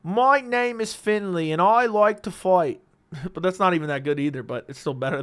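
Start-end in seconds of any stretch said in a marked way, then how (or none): 0.0-2.8 high, slow put-on Irish accent; Conor McGregor accent. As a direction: draw out a hammed-up Irish accent. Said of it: "that's why I always say".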